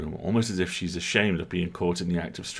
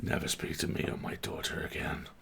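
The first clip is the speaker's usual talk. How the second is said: whispered, cold dismissal; whispered scorn; 4 semitones lower, 11 dB quieter than usual. Acted. The cat dismisses the player in a whisper. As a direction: hiss it with contempt.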